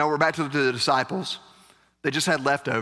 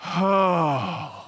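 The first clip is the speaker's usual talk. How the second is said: mock shocked gasp; 4 semitones higher than usual. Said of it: "we're like".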